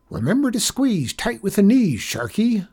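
high, lively riding-coach voice; cowboy janitor, coaching. A narrator's reading. Janitor giving riding tips to the boy, coaching.

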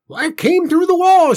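high, loud panicked wail; terrified wail. A narrator's reading. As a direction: wail it out in terror. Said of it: "she wailed".

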